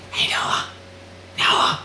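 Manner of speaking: imitated spooky whisper. Journal calling to Noah from below, imitated whisper. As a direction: whisper the name as if calling from hiding. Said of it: "this whispering, like".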